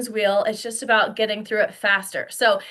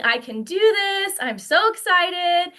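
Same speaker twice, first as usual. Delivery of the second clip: high-pitched gushing enthusiasm; can-do excitement, pitched up; positive, excited; 7 semitones higher than usual. Acted. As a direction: play the thrilled newcomer, drawing out the words. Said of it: "you're having this positive feeling".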